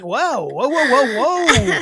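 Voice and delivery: hammed-up "whoa" as urgency hits; alarmed whoas. Acting out the bathroom emergency for co-host, alarmed whoas.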